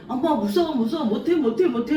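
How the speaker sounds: high, frightened whimpering plea; fearful pleading. Retold or imitated. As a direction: cry out like a scared child to mom. Jacob voiced trembling with fear.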